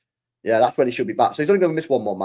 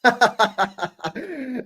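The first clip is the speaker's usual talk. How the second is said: high burst of laughter; 11 semitones higher than usual. Real, not acted. Laughing at a clip.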